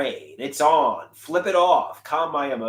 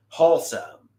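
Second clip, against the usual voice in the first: ordinary talk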